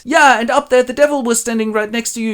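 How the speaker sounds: high, loud put-on voice; fisherman voice. [name] as fisherman tells of the devil, put-on high voice. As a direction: spin the devil tale wide-eyed.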